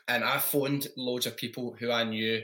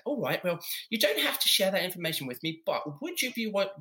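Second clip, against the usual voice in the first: camp caller voice; 6 semitones higher than usual. Retold or imitated. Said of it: "So I kept doing this voice".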